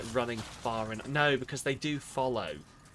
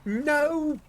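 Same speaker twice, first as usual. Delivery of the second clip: frustrated shout; 13 semitones higher, much slower than usual. Real, not acted. Game death, frustrated outburst.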